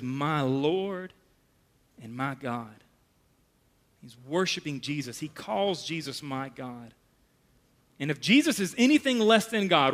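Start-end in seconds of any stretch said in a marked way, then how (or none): 0.0-6.9 dropping almost to a whisper; hushed awe. Real, not acted. Pastor telling Thomas touching Jesus, hushed.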